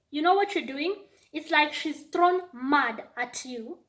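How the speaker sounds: normal talking voice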